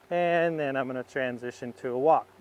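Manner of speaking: ordinary talk, nothing marked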